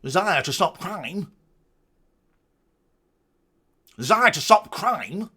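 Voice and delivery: high mock-posh English accent. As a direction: parody a posh delivery of the words. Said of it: "very posh".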